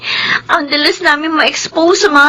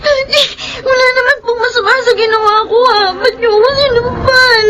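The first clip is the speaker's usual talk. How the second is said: fast, higher tearful pleading; crying protest; 8 semitones higher than usual. Acted. Slapped daughter crying to her father. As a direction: protest through tears.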